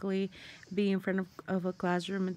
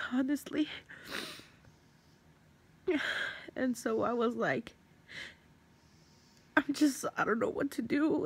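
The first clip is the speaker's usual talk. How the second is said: fighting back tears; 6 semitones higher than usual. Real, not acted. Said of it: "I'm trying not to cry".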